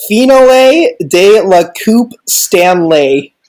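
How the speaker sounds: slow, very anglicised French; un-French reading. Shown as a demonstration. Host reading the Canadian patch, deliberately anglicised French. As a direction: read the French as an American would, slowly. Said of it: "the whitest voice possible and as least French sounding as possible".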